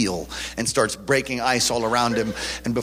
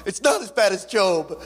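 high, tearful repeated plea, rising to near singing; crying cellmate's plea; 10 semitones higher than usual. Retold or imitated. Cook sobbing to his seasick bunkmate in a storm, tearful high plea. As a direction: weep it out like a born-again cook in a storm. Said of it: "He's like".

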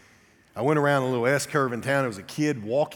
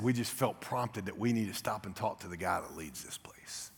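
hushed; 3 semitones lower, 8 dB quieter than usual. Retold or imitated. Couple's hushed words retold.

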